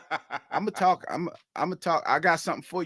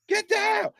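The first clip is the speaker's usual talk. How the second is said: much higher comic cry of alarm; mock panicked yelling. Imitating people hearing his knees pop, high mock panic. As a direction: imitate a bystander warning everyone to duck. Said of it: "you hear people start yelling".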